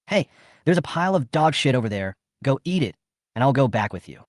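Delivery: usual speaking voice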